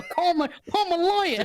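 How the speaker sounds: overacted American TV show line; mock American TV lawyer demand. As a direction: play it like a US courtroom show. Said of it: "This isn't an American T V show".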